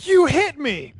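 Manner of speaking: high, loud indignant yelp; acted outraged shout, pitch leaping up; shocked outcry. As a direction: cry out as if just sucker-punched. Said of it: "He's like".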